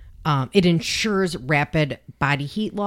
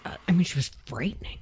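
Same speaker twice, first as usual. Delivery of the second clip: whispering; 5 dB quieter, faster than usual. Real, not acted. Spooky true crime story aside.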